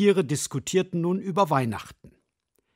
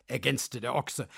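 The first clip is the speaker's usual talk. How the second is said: ordinary delivery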